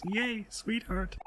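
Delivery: high, slow, tiny squeak; lightly voiced tiny character. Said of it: "in a very tiny voices".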